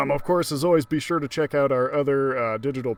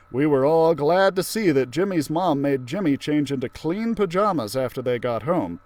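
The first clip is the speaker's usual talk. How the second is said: usual speaking voice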